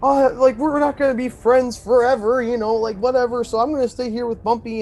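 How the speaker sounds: high-pitched, hammed-up mock tantrum; Ben, temper tantrum. Ben refusing to leave Bumpy, mock tantrum. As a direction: mimic a sulky kid refusing to go. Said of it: "throwing his little temper tantrum".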